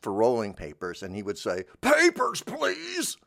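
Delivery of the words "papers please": scary shop-counter shout; sudden startling yell. Retold or imitated. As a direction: blast it at the tobacco clerk. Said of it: "like that, and it would scare the heck out of the".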